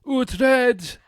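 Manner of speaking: heroic shout. Acted. Co-host hailing the hero Uhtred with a heroic shout. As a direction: bellow the hero's name like a trailer. Heroic call of the hero's name.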